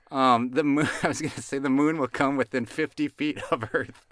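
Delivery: can't keep a straight face; laughing. Real, not acted. Host delivering a silly moon joke, laughing. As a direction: joke told through giggles. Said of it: "I don't know why I'm laughing so much".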